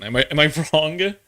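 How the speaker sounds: normal talking voice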